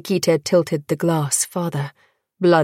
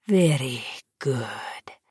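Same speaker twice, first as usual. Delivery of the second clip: slow satisfied whisper; 4 semitones lower, 5 dB quieter, slower than usual. Acted. Vampire whispers approval.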